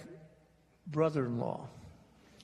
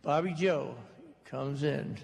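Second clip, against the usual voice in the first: ordinary delivery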